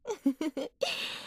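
a short, soft rising giggle; light teasing laugh under the breath. Acted. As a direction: giggle at him playfully. Teasing the boss.

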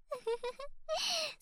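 high, breathy little scornful laugh; airy mocking giggle. Sister laughing at her pathetic brother, mocking giggle. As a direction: laugh down at him, amused.

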